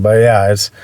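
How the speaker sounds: normal talking voice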